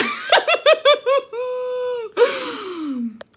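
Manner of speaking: long high-pitched cackle; real laughing fit. Real, not acted. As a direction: crack up helplessly in a high squeal. Laughs at own story.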